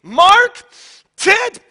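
high, loud mock yelling of the boys' names; imitating mad mom. Mom, upset with her son, yelling through the brothers' names, mock angry shout. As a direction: call the sons like an upset mother, hammed-up. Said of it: "she was just so upset".

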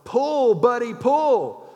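high, loud call to a horse; farmer's yell. Acted. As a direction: holler it out, drawing out the last word. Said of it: "he yells".

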